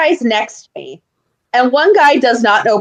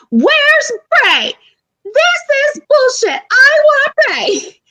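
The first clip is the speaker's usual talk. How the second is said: very high, slow wailing yell; whiny shout at the arena; top of my lungs; much slower than usual. Retold or imitated. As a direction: scream it like a spoiled child. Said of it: "literally at the top of my lungs".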